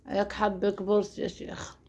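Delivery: breathy mocking whisper aside. Real, not acted. (همس ساخر)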